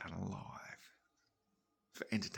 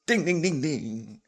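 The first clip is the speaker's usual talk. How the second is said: loud, high ding ding ding; rising bell rings, then one last ding; imitated bell dings; 8 semitones higher, 11 dB louder, slower than usual. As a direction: ring the bell impatiently with your voice. Impatiently ringing reception bell.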